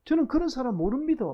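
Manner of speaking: high, loud Gyeongsang dialect protest; flustered Gyeongsang dialect. Acted. Flustered Peter denying Jesus to Seoul people, high Gyeongsang dialect. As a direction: deny it in a flustered Gyeongsang lilt, pitched right up. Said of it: "이번에도 당황한 베드로가".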